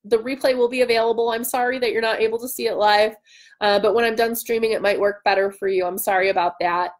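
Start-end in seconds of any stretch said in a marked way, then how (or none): none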